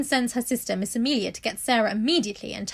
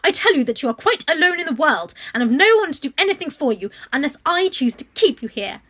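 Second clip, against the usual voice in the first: loud, higher contemptuous pronouncement; harsh headmistress; 3 semitones higher, 9 dB louder than usual. A narrator's reading. Headmistress telling orphan she is poor: loud contempt. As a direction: spit the word beggar at her.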